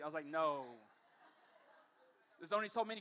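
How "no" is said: deadpan refusal. Retold or imitated. Refusing aunt's setup.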